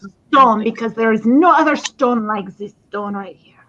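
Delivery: a hammed-up Russian-French sales pitch; Russian French accent. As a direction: sell the stone like a shady Russian-French dealer. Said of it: "It's kinda like Russian French".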